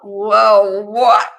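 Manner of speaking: loud, high shocked whoa what. Retold or imitated. The group reacting to the lady's claim, loud and shocked. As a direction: blurt it out in disbelief. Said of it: "And we're like".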